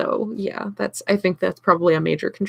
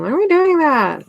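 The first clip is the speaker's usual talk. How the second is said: put-on kid voice; 8 semitones higher, 9 dB louder than usual. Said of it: "Kids keep asking".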